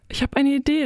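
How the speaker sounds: höher und eifrig, eine Idee verkündend; plötzlich einen Einfall laut ausrufen; begeistert gerufen. A narrator's reading. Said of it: "rief ich". (höher, begeistert gerufen)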